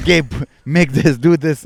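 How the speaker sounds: laughing mock-demanding orders to Gabe; bossy nagging. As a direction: boss him around loudly. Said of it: "'cause I kept like".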